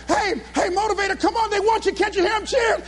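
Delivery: high, insistent summons; urgent coaxing. Retold or imitated. Organisers say crowd is cheering, urgent. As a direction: imitate excited handlers rousing the star. Handlers urge him on stage.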